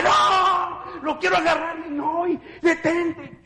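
high cries slowing into a plea; panicked pleading. Retold or imitated. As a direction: beg him to stop, terrified. Reliving second foot. (súplica aterrada y aguda)

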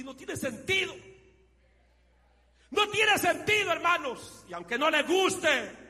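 high, loud preacher's cry; full-force preaching. Acted. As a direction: shout the call to stay on fire. (predicación a todo pulmón)

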